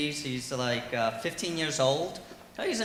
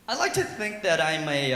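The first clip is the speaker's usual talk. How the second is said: ordinary delivery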